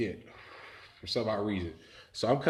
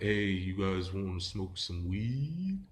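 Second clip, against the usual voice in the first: slow, drawn-out offer rising at the end; reptilian dude's voice; 3 dB quieter, slower than usual. Retold or imitated. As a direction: stretch it out like a spaced-out creep. Reptilian friend hails the police.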